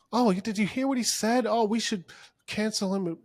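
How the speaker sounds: high-pitched offended gossip; mock-scandalized whine; mocking oversensitivity. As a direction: mimic a pearl-clutcher in a high voice. Mimics oversensitive people wanting cancellation.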